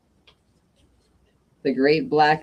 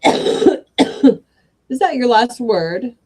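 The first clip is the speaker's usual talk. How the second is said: slow, loud, higher gnome voice; a stubborn union gnome drawing out his demand; the head gnome stretches out his ultimatum, slow and loud; 6 semitones higher, 7 dB louder, much slower than usual. Acted. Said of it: "said Sean O'Haircut, the head gnome".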